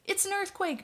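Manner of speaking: high yelp of alarm, pitched well up; panicked cry that the ground is shaking; alarmed yell. A narrator's reading. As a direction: shout the warning as the house shakes. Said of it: "Jim Bob yelled".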